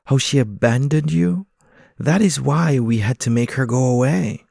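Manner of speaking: high, loud pigeon voice, insistent; pigeons pleading. Acted. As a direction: voices nagging him to believe. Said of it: "one pigeon says".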